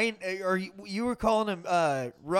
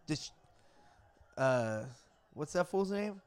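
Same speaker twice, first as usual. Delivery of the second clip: ordinary talk, nothing marked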